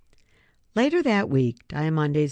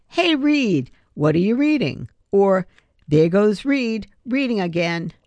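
mocking kids; 5 semitones higher, 5 dB louder than usual. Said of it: "People always say to me".

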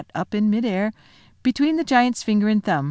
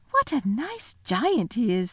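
Lucy's fond remark; 4 semitones higher, slower than usual. Acted. Lucy to Tumnus.

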